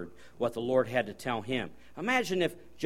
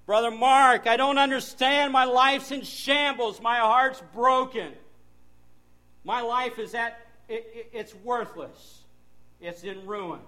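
higher, slow, pleading heartbroken plea; despairing counselee; 10 semitones higher, 5 dB louder than usual. Retold or imitated. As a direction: play a broken person begging the pastor for help. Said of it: "People come and they say".

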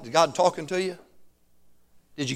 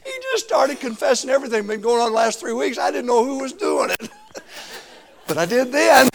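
laughing; 7 semitones higher, 6 dB louder than usual. Real, not acted. Laughing through retelling.

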